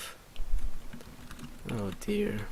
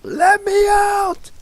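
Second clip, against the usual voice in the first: desperate yell; 6 dB louder, much slower than usual. Acted. Prisoner banging on the bars.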